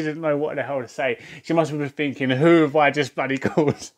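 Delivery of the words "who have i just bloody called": laughing. Real, not acted. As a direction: quote broken by a real laugh. Laughs through imagined caller's thought.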